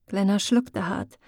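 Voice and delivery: plain reading voice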